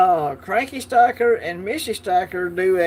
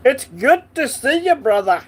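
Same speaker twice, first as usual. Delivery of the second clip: hammed-up Scottish accent, pitched higher and louder; 8 semitones higher, 6 dB louder than usual. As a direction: greet him like a Scot, playfully overdone. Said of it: "I have to do the accent every time".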